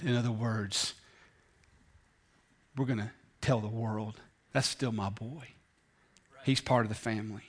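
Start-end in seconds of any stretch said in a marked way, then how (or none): 4.5-7.4 tender father. Acted. Father's voice, soft.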